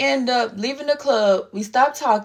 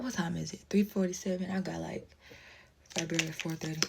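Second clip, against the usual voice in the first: much softer, mumbled aside; muttered aside; 5 semitones lower, 14 dB quieter than usual. Real, not acted. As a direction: check the time under your breath.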